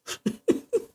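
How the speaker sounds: a short, airy, unvoiced giggle; breathy laugh. Real, not acted. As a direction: laugh it off, embarrassed. Laughs at her own hesitation.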